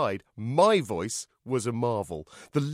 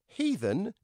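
high, rising puzzled question; innocently earnest query; earnest inquiry; 10 semitones higher than usual. A narrator's reading. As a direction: ask it in all earnestness. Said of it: "inquired Derek earnestly".

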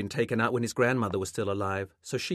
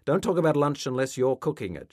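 raised, louder, higher retort; irritated rebuke; 5 semitones higher, 6 dB louder than usual. A narrator's reading. Mother to son who suggested eating, irritated rebuke. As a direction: cut the subject off with irritation.

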